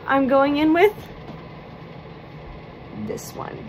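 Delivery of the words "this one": hushed unvoiced reveal; secretive whisper.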